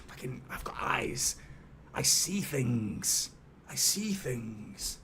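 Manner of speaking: slow, drawn-out spooky whisper; hushed boast. Pretend. Host claiming he spotted the offside, eerie whisper. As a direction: whisper it slowly like a seer.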